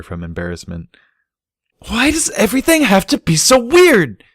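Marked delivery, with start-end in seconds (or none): 1.9-4.2 put-on high, loud teenage scream. A narrator's reading. Embarrassed filly screaming at her family. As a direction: scream it out in exasperation. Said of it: "Sparkler screamed".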